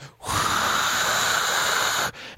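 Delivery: long, steady airy exhale; hard blowing. Retold or imitated. Driver re-enacting a breathalyzer test, long hard blow. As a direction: blow as if filling your last balloon. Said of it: "like I was blowing up my last balloon".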